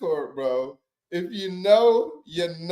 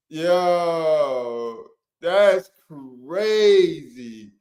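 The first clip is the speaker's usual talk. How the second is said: very slow drawn-out that's crazy; slow stunned drawl; drawn-out disbelief; much slower than usual. Real, not acted. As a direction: stretch it out slowly in disbelief.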